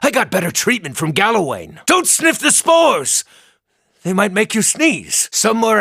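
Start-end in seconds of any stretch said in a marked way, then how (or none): 1.8-3.2 urgent warning shout. Acted.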